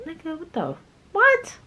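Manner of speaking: what-the squeal of disbelief; stunned disbelief. Real, not acted. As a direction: voice shoots way up in outrage. Disbelief at losing money.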